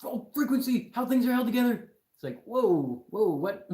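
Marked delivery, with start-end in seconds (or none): 0.0-1.9 high, very loud mocking shout; exaggerated high-pitched yell. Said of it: "shouted out". 2.4-3.7 loud, high startled reaction; exaggerated bewildered outburst; mock astonishment.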